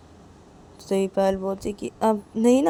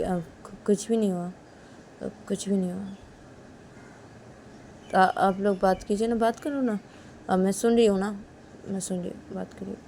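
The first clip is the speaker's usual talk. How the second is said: much softer, hesitant, withdrawn reply; hurt and quiet; 8 dB quieter than usual. Acted. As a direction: mumble that nothing is wrong, sulking. Hurt Payal answers quietly, withdrawn. (धीमी, झिझकती, उदास आवाज़ में)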